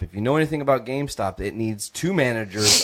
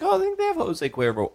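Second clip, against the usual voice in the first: high voice. Retold or imitated. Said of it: "I can't do the high voice".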